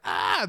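loud hammed-up scream of fright; mock scream. Scared by the ghost's warning, a loud mock scream. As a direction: yelp like you're spooked. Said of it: "I'm like".

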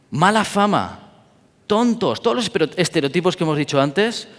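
ordinary delivery

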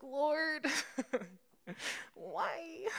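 high, hammed-up lament; mock plaintive plea. Crash victim questioning the Lord on the drive, mock plaintive. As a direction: wail it lightly, as if put upon.

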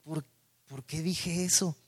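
soft, stammering regret under the breath; muttered self-reproach. Retold or imitated. A man at home, regretting his words, muttering softly. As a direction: mumble it, kicking yourself for what you said. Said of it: "luego en casa dices".

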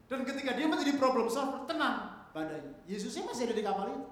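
emphatic sermon delivery; impassioned preaching. Real, not acted. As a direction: proclaim it from the pulpit, starting loud then easing slightly. Preaches at full force.

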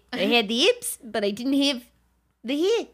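put-on amateur New Zealand accent sliding into Australian. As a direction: squeeze the vowels into a mock New Zealand accent. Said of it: "I think I'm just doing my Australian".

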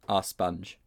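ordinary delivery

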